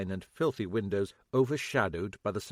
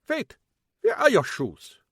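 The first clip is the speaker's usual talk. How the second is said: put-on higher, loud, foreign-accented yelling; irate immigrant shouting at police at his door; angry German shopkeeper; 10 semitones higher, 9 dB louder than usual. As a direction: chase them off in a thick German accent.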